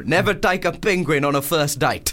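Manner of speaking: put-on South African accent. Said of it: "that's a good South African accent".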